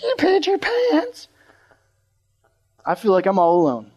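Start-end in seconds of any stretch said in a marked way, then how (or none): 0.0-1.3 imitated taunting scream. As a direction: mimic screaming kids in a thin high squeal. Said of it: "point and laugh and scream".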